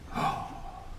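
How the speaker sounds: a hushed breathless gasp; soft gasp of wonder. Acted. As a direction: gasp quietly as if amazed. Wonder at the picture.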